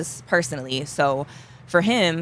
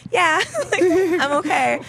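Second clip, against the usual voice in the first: 1 teary laugh; 10 semitones higher, 3 dB louder, slower than usual. Retold or imitated. Retold relief after escape.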